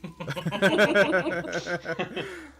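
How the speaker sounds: long amused laugh. Real, not acted. GM laughs at player's joke, long laugh. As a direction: let a long laugh roll.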